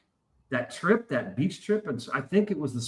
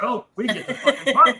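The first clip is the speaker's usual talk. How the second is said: high, laughing, louder glee; happy party glee; 9 semitones higher, 7 dB louder than usual. Retold or imitated. Imitating the drunk bartender cheering about partying, laughing. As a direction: crow with delight over a fresh rookie. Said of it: "he was all fucking happy".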